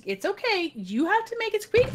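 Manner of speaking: acting out a coaxing adult. Coaxing the child to try.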